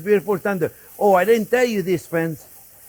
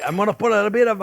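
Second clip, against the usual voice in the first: usual speaking voice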